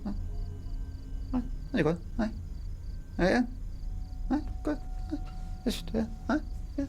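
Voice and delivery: high, soft, dismissive aye aye on you go; sarcastic high voice shooing him along; mocking high brush-off. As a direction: wave him off in a mock falsetto. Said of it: "Like that".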